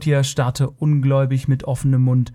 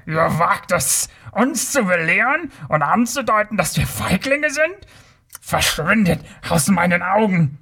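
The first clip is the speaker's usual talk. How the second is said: high, outraged Kothian tirade without real volume; wütendes Brüllen; 6 semitones higher than usual. A narrator's reading. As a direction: rage at the insult, pitch climbing. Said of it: "brüllte er". (empörte, sich hochschraubende Wut)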